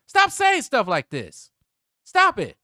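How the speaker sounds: shouted order, every word hammered out; exasperated shout. Real, not acted. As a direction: yell it at full volume, high and slow. Repeats the scolding, shouting.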